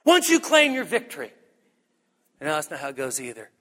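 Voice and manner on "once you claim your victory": high, punchy victory proclamation; mock triumph. Preacher misquoting Jesus to the church, mock triumphant proclamation. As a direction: proclaim it like a hammed-up rally, pitched up. Second wrong, triumphant version of the verse.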